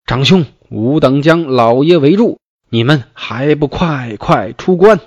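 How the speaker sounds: calling out loud and high to the brothers. A narrator's reading. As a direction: shout the demand across the pass. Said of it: "只见黄明大叫曰".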